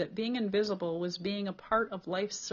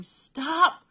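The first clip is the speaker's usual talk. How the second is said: sharp, high, loud gasp of pain; gasped plea; 9 semitones higher, 10 dB louder than usual. A narrator's reading. Beaten boy gasping at attacker to stop. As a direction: cry out mid-beating, winded. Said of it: "Cole gasped".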